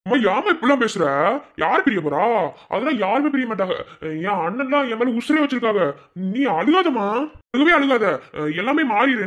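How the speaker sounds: high, loud, emotional reassurance; distraught pleading. Acted. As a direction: plead with a crying mother, pitch soaring. Distressed daughter consoling crying mother. (பதறிப்போய் உரத்த குரலில் கெஞ்சல்)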